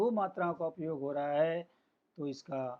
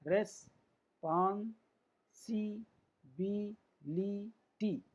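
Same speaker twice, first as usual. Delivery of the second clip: Responsibility very slowly, every syllable alone; six drawn-out beats with gaps; 3 semitones higher, much slower than usual. Shown as a demonstration. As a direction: count out each syllable of responsibility. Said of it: "स्वर का उच्चारण हो रहा है".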